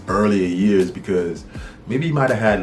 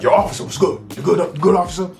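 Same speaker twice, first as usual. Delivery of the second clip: [name] impersonation; 9 semitones higher, faster than usual. Retold or imitated. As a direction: hail the cops like [name], pitched up. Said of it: "He was like".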